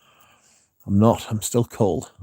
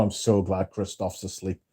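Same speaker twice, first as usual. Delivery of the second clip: ordinary talk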